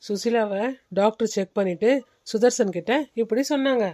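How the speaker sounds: ordinary delivery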